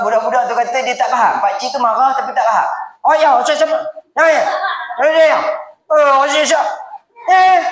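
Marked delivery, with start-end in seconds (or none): 3.1-7.6 mock angry shout. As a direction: bark at the child like a cranky old uncle. Gruff uncle shouting at child. (jeritan pakcik garang yang dibuat-buat)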